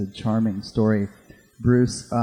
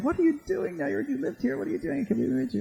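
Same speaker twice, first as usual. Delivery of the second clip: much higher, excited questions; very high fan voice; 5 dB quieter, faster than usual. Retold or imitated. Fan asks Bruce to meet, very high and giddy.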